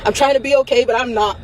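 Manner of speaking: ordinary delivery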